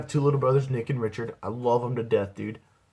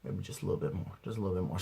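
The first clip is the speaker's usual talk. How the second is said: soft, sneaky ask for more; hushed greedy; 4 semitones lower, 9 dB quieter, faster than usual. Retold or imitated. Eyeing the big jar, soft sneaky ask. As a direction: coax it under your breath.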